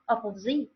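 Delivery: "up of Z" in place of opposite; misheard pronunciation. Shown as a demonstration. Teacher showing how learners make it up, misheard pronunciation. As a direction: say "up of Z", ending on "zee". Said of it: "You can think of making this up".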